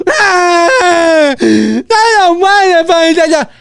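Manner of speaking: high, loud mock wail; drawn-out comic tantrum cry; bawling child. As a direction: imitate a kid screaming through tears. Imitating a bawling child in cinema.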